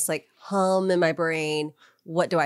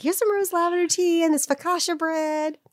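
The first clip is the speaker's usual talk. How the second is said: high, sugary flirty vendor; sweet vendor voice; 12 semitones higher than usual. Retold or imitated. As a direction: offer it sweetly like a flirty seller. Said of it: "she's like".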